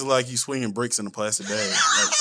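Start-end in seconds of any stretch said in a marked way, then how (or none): 1.7-2.2 high squealing laugh. Real, not acted. Laughing at own joke.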